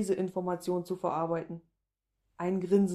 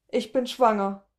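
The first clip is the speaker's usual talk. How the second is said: loud, higher, unthinking outburst; blurted out; 4 semitones higher, 8 dB louder than usual. A narrator's reading. Woman confessing pregnancy to a stranger, blurted out. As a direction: blurt it out before you can stop. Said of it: "platzt es unüberlegt aus mir heraus".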